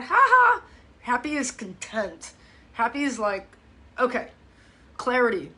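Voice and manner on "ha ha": high, loud fake "ha ha"; a cartoonish fake laugh, pitched up; mock laugh. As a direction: toss off a hollow giggle. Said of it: "happy is not".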